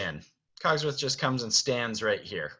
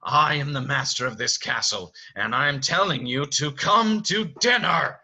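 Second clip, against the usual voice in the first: commanding, building louder to the end; Beast yelling; 4 semitones higher, 5 dB louder than usual. Acted. Master ordering Belle to dinner through door, angry. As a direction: assert authority, swelling to a yell. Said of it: "he yells, screams at her almost".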